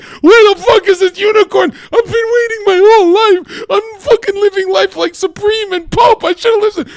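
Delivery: very high, loud mock wail; despairing yell. Failed unicorn seeker laments his life, despairing yell. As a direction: cry out in hammed-up anguish.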